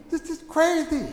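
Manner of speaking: high, astonished reaction; retold amazement. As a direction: replay giddy disbelief. Retelling thrill at the gospel.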